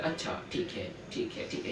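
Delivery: checker's murmured okay, okay under the breath; whispered mutter. Retold or imitated. Teacher imitating a paper checker, whispering to himself. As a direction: mutter it to yourself while skimming pages.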